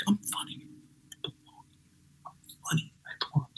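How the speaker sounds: very soft whisper to himself; whispered pep talk. Retold or imitated. Psyching himself up before going on stage, whispered. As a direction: whisper it like a private mantra.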